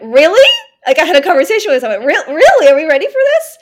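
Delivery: can't believe it, loud and high; astonished. Retold or imitated. She asks her own body if she is ready for the drive, stunned and loud. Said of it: "And I went".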